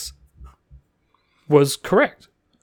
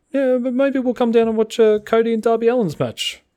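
mock menacing Taz; 9 semitones higher than usual. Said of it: "menacingly says".

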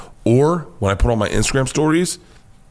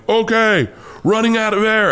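panicky high alarm; 11 semitones higher than usual. Retold or imitated. Panicked diver tells his buddy.

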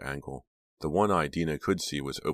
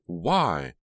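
shrill cry; 3 semitones higher, 6 dB louder than usual. A narrator's reading. Jade asking Teddy why, shrill cry. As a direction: cry it out once more. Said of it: "Jade cried shrilly".

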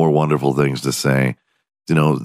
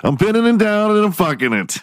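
much higher, exaggerated mimicry of the man; mocking imitation; faster than usual. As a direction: echo him in a high mock voice. Mimicking the clip just played.

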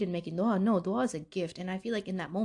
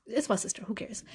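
whispered confession, breathless and hushed; 5 dB quieter than usual. Retold or imitated. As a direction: let it slip out as a secret whisper. Confiding about crying to sister.

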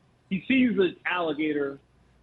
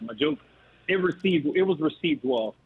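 usual speaking voice

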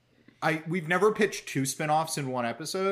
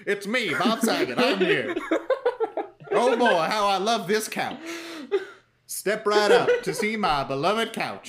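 higher, louder barker voice, laughing; carnival barker; 5 semitones higher, 3 dB louder than usual. Retold or imitated. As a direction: step right up, showman style. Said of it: "kind of a carnival barker".

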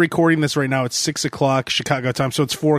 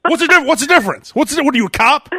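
high, mock-outraged comeback; touchy Sam; 9 semitones higher, 3 dB louder, faster than usual. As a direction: fire back in exaggerated indignation. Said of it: "He's like".

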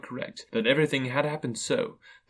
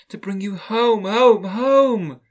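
put-on high child voice, building louder; gleeful little girl; 12 semitones higher, 9 dB louder, much slower than usual. A narrator's reading. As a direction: chant it with a child's bursting joy. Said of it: "bending down to laugh".

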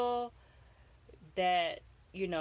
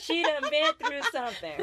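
put-on Florida accent; 7 semitones higher, 5 dB louder than usual. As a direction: lean into the Florida twang, giggling. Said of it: "That's a Florida accent".

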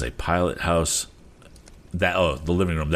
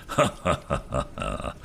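low, acted chuckle trailing softer; captain's laugh; 3 semitones lower, 5 dB quieter than usual. A narrator's reading. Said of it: "laughed Captain Weston".

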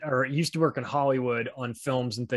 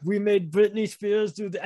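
light mock German accent, pitched higher; Klaus Schwab Junior German accent; 6 semitones higher than usual. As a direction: ham up a German accent in a rambling voicemail. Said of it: "it's just Klaus Schwab Junior just going".

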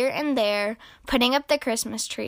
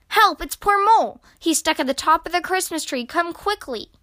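high, hurried plea for help; urgent, panicky panting; 7 semitones higher, 4 dB louder than usual. A narrator's reading. Rabbit begs Badger to rescue Mole, urgent and panicky. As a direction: cry out high, then rush the news. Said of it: "panted Rabbit".

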